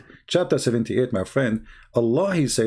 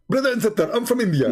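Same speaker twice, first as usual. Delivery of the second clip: put-on high-pitched Indian accent; 6 semitones higher than usual. Debater answers a remark about his accent, high Indian accent. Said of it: "This is the Indian accent".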